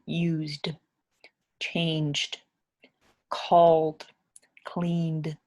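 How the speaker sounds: "used, changed, called, cleaned" each ending on a clear voiced d; d sound endings. Shown as a demonstration. Teacher to English learners, modelling the voiced d ending. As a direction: lean on the final d of each verb. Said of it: "that makes a duh sound at the end".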